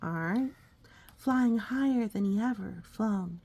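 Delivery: ordinary delivery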